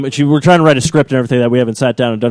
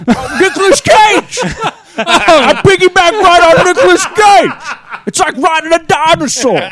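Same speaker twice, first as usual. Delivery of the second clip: very high, loud, slow wailing impression; Tracy Morgan; 4 dB louder, slower than usual. Comedian explaining how he climbed the steps, high loud impression. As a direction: stretch it out in a high, exclaiming comic voice. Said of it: "Tracy Morgan is also another one".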